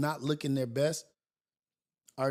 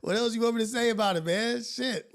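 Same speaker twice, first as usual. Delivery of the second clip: exasperated; 8 semitones higher, 4 dB louder than usual. Real, not acted. Exasperated reply to chat.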